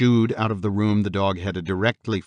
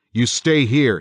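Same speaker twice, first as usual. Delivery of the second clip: loud, higher-pitched command; yelled order; 5 semitones higher, 7 dB louder than usual. A narrator's reading. Father orders wife and boys to stay, yelling. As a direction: call it out urgently. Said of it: "he yelled at Kathy and the boys".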